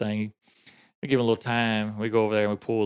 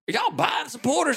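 very high mock-angry challenge; hammed-up, high-pitched heckle; getting after them. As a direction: imitate an irritated man needling visitors. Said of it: "he was getting after us".

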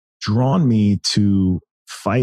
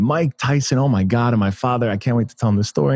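usual speaking voice

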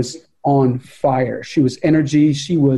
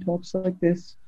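much softer, showing her tiny voice; quiet-spoken; 11 dB quieter, slower than usual. Shown as a demonstration. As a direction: drop almost to nothing to show how she speaks. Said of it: "very quiet-spoken".

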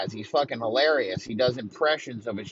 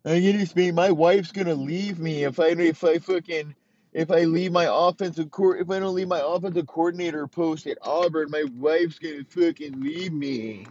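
pitched up, whiny mock excuse; mock whiny coach; 4 semitones higher than usual. Mocking a coach who quits over his wife, whiny.

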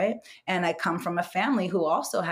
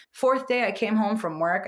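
normal talking voice

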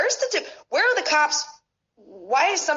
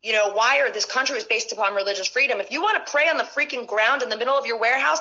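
ordinary talk, nothing marked

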